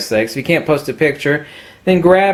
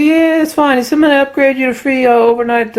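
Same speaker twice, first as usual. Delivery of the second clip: put-on higher, louder friendly old clerk; old salesman impression; 12 semitones higher, 4 dB louder than usual. Old clerk back on the phone with the lens, lightly voiced. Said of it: "He says".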